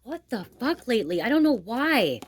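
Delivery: high, loud, stunned exclamation; loud disbelief. Real, not acted. Tarot reader reacting to the cards, loudly baffled. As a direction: blurt it out, baffled and shaken.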